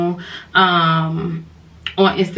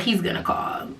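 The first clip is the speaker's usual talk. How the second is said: softer, breathless whisper to herself; 7 dB quieter than usual. Real, not acted. Teller hoping the friend calls, whispered to herself. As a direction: whisper it like a secret hope.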